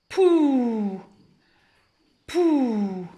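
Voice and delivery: "Pou" popped and held as "pouuu"; blended syllable. Shown as a demonstration. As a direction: join P to a long vowel in "Pou", twice. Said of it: "lire ces deux lettres en même temps". (« Pou » lié, voyelle très allongée)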